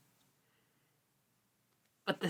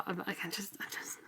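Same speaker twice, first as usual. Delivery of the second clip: trailing whisper; 14 dB quieter, faster than usual. Real, not acted. Trails off in frustration.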